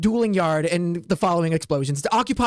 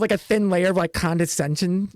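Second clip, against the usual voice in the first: normal talking voice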